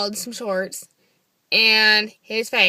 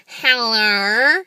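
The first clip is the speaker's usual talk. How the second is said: loud, put-on hello; off-putting phone greeting; 7 dB louder than usual. Shown as a demonstration. Woman showing how she answers his call, exaggerated voice. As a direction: answer in an exaggerated, scare-him-away voice. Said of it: "Maybe my voice is scaring him away".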